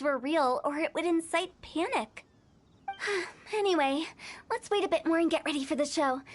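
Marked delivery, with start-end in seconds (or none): 2.9-3.5 soft resigned sigh. Real, not acted. Sigh about hiding the truth.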